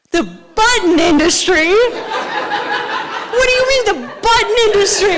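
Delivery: high, loud, incredulous yell; outraged disbelief. Retold or imitated. Storyteller reliving the news about bivalves, outraged disbelief. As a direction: repeat it back in hammed-up outrage, stretching it out. Acts outraged reaction to the answer.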